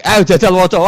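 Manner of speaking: high, loud barked order; stern angel command. Pretend. Munkar Nakir ordering Umar to read, loud and commanding.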